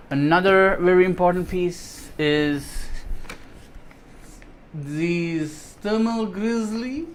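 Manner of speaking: slow drawn-out German accent; German accent attempt. Shown as a demonstration. Unboxing thermal paste for viewers, in a failed German accent. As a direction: try on a German accent and miss. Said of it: "that's a wrong accent".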